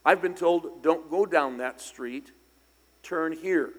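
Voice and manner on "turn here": ordinary talk, nothing marked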